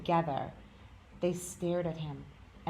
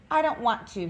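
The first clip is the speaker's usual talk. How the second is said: defensive little rabbit; 6 semitones higher, 7 dB louder than usual. A narrator's reading. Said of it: "he said again".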